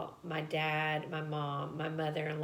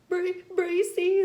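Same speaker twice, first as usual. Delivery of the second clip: high, loud, gasped-out stammer in imitation; too breathless to talk; 13 semitones higher, 9 dB louder, slower than usual. Patient telling receptionist her doctor's name, imitated panicked breathlessness. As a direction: mimic forcing a name out while hyperventilating. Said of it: "I can't even talk".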